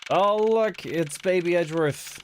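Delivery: delighted squeal. Real, not acted. Delighted at young character.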